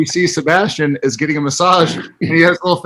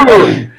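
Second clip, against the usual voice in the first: mock pleasured "ooh", louder; enjoying it; 5 dB louder than usual. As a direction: imitate a delighted massage moan. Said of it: "he is enjoying it".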